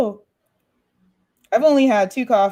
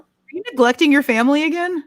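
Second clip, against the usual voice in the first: mock scolding; 9 semitones higher than usual. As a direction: scold her in jest, up high.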